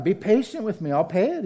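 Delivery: lightly voiced pleading servant. A narrator's reading. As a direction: beg him mildly for time. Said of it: "he pleaded".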